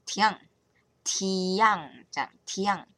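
slow careful "tian", each said apart; French word taught. Shown as a demonstration. Teaching friends a French word, slow and careful. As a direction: spell out "tian" slowly for learners. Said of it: "教大家一句法文单字叫做tian".

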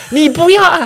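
high, loud mock yell; hammed-up angry yell; scolding yell. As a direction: shout at the patient. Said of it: "他说".

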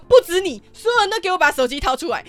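loud high yell at the whole table; retold shout. Yelling at all the coworkers at dinner: loud commanding shout. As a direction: shout the order at everyone.

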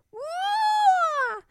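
very high scared wah; a squealing ghostly yelp; the little ghost frightened by the boo; frightened wail. A narrator's reading. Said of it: "said the little ghost".

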